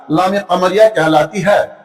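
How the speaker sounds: normal talking voice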